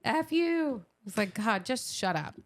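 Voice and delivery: ordinary delivery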